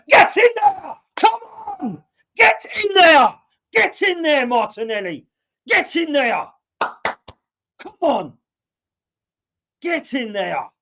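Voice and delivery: fan's real celebratory yelling after the goal; goal celebration. Real, not acted. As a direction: roar it out in pure joy.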